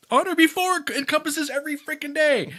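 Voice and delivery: high, loud outburst of frustration; exasperated rant. Real, not acted. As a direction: throw the words back, loud and exasperated. Ranting about trash website rule.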